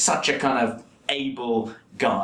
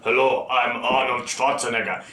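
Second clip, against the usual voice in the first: mock Austrian accent. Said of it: "it's like".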